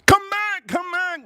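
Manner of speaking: mock high yell at a departing spaceship; hammed-up desperate call after a rocket; Elon Musk screaming. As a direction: shout way up high, pleading it to return. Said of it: "you see Elon Musk screaming at his".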